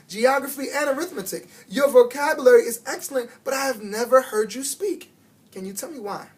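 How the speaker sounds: earnest teacher. A narrator's reading. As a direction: build her up, then ask gently. Teacher praising, asking why.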